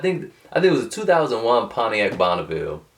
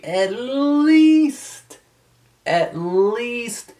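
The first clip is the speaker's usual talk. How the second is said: "at least" stretched long, pitched high; drawn-out emphasis; 14 semitones higher, much slower than usual. Real, not acted. Advising car buyers on age, "at least" stretched emphatically. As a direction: hold "least" high and long, both times.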